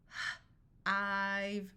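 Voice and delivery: puffed H, pause, then long "ive"; sounded out. Shown as a demonstration. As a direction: break "h-, ive" into separate sounds. Phonics teacher sounds out, then blends.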